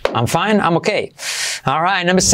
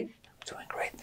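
very soft whisper; hushed, voiceless repeat; whispered echo; 25 dB quieter than usual. Shown as a demonstration. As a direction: whisper it back as a prompt.

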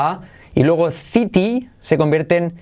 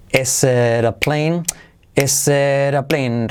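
"is it a plane" stretched as "eseeraa plane"; slow linked drill; much slower than usual. Shown as a demonstration. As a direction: drill "ésera plane" very slowly. ("is it a plane" muy lento y unido)

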